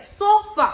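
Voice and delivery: Sofa with a punched SO, weak 'fuh'; stress on SO. Shown as a demonstration. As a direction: hit the first syllable of sofa, let the second drop. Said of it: "उस पे इतना stress नहीं जितना सो पे है". (Sofa में 'सो' पर ज़ोर, 'फ' हल्का)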